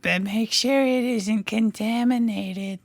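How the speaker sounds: put-on high, slow eerie child voice; zombie-ish girl voice. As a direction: drag it out like a creepy little girl. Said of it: "Is she a zombie at this point?".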